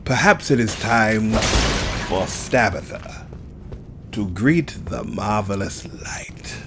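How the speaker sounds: slow, deep, solemn narrator; dramatic narrator. Pretend. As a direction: announce it like an epic trailer. Dramatic mock ceremonial line.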